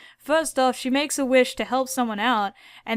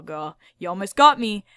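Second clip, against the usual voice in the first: ordinary delivery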